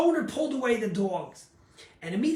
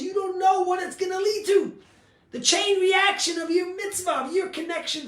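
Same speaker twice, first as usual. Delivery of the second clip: louder and higher, calling it out to the room; preaching shout; 7 semitones higher, 6 dB louder than usual. Real, not acted. Rabbi urging his congregation, in a rising preaching shout. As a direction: belt it out like a preacher hammering his point.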